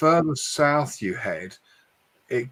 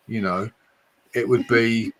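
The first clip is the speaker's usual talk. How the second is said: ordinary delivery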